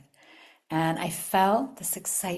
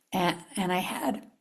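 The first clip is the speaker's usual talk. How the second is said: normal talking voice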